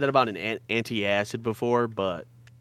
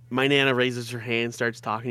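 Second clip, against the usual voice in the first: ordinary talk, nothing marked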